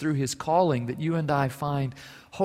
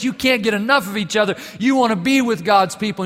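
louder, higher, rising sermon pitch; full-force preaching; 6 semitones higher, 4 dB louder than usual. Acted. Pastor urging his congregation, preaching with full force. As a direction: preach it with mounting fervor.

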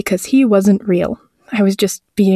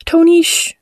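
high, urgent hushing; quiet cry; 9 semitones higher than usual. Acted. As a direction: hush the cat in a high, anxious voice. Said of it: "I cried quietly".

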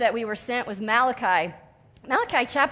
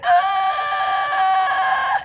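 short high loud comic wail; mock bride wail; 7 semitones higher, 5 dB louder than usual. Weeping bride hurting the groom's ears, loud comic wail. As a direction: let out a hammed-up bride's cry. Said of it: "who's like".

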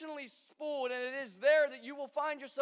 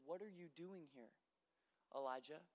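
very soft, low, hushed question; still small voice; 8 semitones lower, 16 dB quieter than usual. A narrator's reading. God speaking to Elijah in a still small voice. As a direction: barely breathe it. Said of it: "a still small voice".

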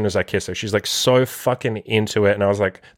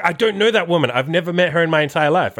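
high-pitched imitation of her insisting; higher, mock-adamant retelling; emphatic denial; 6 semitones higher than usual. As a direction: act out her amazed protest, voice raised.

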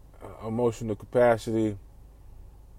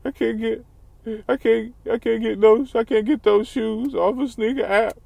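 mock whiny crier; 9 semitones higher than usual. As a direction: whine like a crybaby who can't get sneakers.

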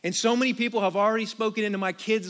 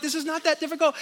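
higher, pushed scolding voice; mock exasperated scolding; 8 semitones higher, faster than usual. Dad scolding his son over wrong homework, higher and exasperated. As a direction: lecture him like a fed-up dad. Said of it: "And I'm just like".

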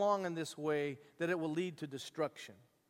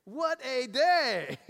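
louder and higher, slow and savoring; joyful exclaim; 5 semitones higher, 6 dB louder, much slower than usual. Real, not acted. Preacher rejoicing over the forgiven debtor. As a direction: celebrate the freed slave's day.

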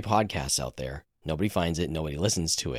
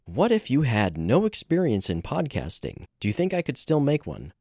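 upbeat question read; 8 semitones higher than usual. Acted. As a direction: announce the final question with energy. Reading listener's question aloud.

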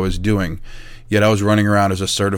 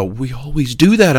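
higher, voiced cry of mock alarm; imitated alarm; 4 semitones higher than usual. Technicians turning around again in class, mock alarm. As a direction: imitate a worried employee protesting. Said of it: "they'd turn around and go".

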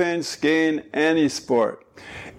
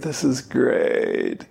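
slow, creaky ending; vocal fry; 5 semitones lower, much slower than usual. Shown as a demonstration. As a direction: drag out a creaky end. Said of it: "Every sentence at the end is like this".

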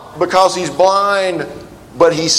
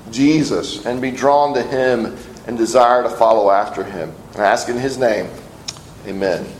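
prayer; 4 semitones lower than usual. Acted. Leads a prayer.

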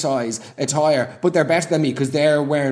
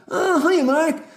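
much higher, sing-song greeting; put-on Susan voice; 13 semitones higher, slower than usual. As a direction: chirp a fake-sweet hello to Mark. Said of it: "why are you speaking like that?".

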